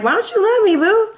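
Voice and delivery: high whiny plea to a lover; mock pleading for love. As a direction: beg in a high put-on voice.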